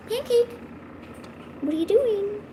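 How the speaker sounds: high sing-song cooing at a cat; pet talk. Real, not acted. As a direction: coo at the kitty, pitch way up.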